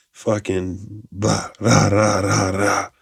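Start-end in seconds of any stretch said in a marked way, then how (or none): 1.6-2.9 imitating a rampaging kid with gruff rah noises; mock kid rampage. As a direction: growl out rah like a wild toddler. Imitating kid's destructive noise.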